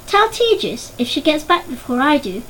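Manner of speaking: plain reading voice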